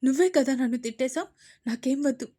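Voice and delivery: loud, aggrieved whine; hurt complaint. Acted. Daughter complaining to mother, aggrieved and loud. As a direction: complain bitterly.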